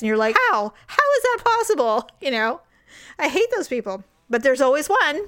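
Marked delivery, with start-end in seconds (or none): 0.5-2.1 high, baffled mock disbelief; incredulous. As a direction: ask in mock amazement. Imitated envious teen.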